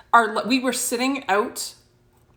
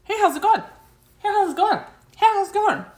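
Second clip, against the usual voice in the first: put-on high, childlike sing-song greeting; a little ghost boy calling hey over and over; little boy spirit voice; 11 semitones higher, faster than usual. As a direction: chirp it like a small boy peeking in. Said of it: "He's just like".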